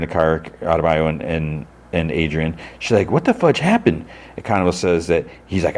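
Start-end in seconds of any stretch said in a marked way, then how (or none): 3.1-4.1 high, startled question; mock shock. Adebayo seeing beaten Chris, high and shocked. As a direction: throw it up high in alarm.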